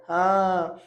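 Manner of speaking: normal talking voice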